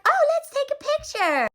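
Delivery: high, sing-song mock mom; bright high "oh" of a cheery mom; chirpy mom. Mom calling everyone for a photo, high and chirpy. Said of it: "the mom is like".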